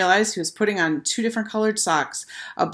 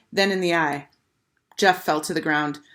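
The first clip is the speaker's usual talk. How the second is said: ordinary delivery, nothing marked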